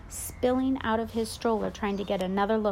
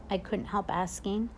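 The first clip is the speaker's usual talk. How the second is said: ordinary delivery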